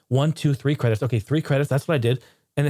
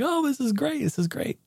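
a light higher, softer mom voice; mom gushing; 12 semitones higher, 4 dB quieter than usual. Retold or imitated. Vlogger's mom at the casino, lightly voiced happy gush. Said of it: "She's like".

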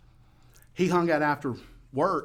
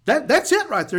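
high, loud and eager; excited agreement; 13 semitones higher, 9 dB louder than usual. Retold or imitated. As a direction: shout agreement over the music. Excited shouted exchange over music.

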